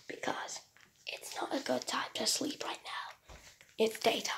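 whispered robot voice. Acted. Robot answers its owner.

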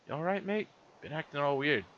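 high-pitched faux Aussie-British voice; bad Australian kid accent. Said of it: "with kid's accent".

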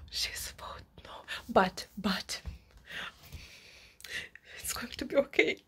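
soft whispered self-reassurance; whispered "it's going to be okay". Real, not acted. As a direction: whisper to calm yourself.

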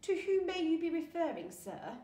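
high, loud, put-on posh English. A narrator's reading. Attendant to a looming guest, affected posh voice. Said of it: "putting on a posh voice".

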